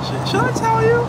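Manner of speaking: sing-song teasing question. Real, not acted. Teasing wife with secret.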